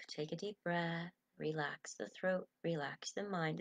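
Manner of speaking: soft, slow breathing cue; slow calming guidance. Shown as a demonstration. Coach guiding listeners to breathe, soft and slow. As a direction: ease them into relaxing, gently. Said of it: "I'm doing it in slow-mo for you".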